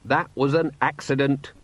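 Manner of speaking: usual speaking voice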